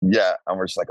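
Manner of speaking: usual speaking voice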